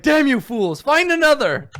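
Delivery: high, loud, exasperated yell; Bluto. Acted. Knight leader to his fools in the dark, angry shouting. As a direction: berate your hapless men at full voice. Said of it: "and then Bluto is like".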